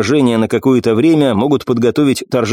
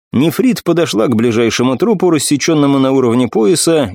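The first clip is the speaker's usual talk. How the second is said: ordinary delivery, nothing marked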